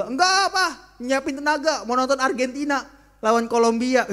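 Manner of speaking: loud, high, laughing refusal; whiny son refusing. Acted. Son loudly refusing his father with a laugh. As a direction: brush him off like a stubborn boy.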